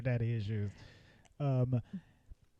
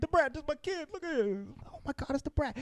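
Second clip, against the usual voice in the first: high-pitched, softer excited dad voice; mock starstruck excitement; 12 semitones higher, 4 dB quieter than usual. Dad introducing his kid to [name], in high mock excitement. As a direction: gush in a high put-on voice.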